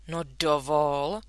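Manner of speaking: slow offended protest; a touch of affronted dignity; offended exclamation. A narrator's reading. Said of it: "zvolal tatínek". (dotčeně, pomalu)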